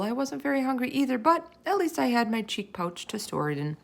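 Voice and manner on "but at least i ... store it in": usual speaking voice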